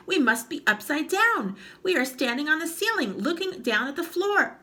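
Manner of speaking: high, loud panicky voice; alarmed. Acted. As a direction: gasp it out in alarm. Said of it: "gasped Mister Twit".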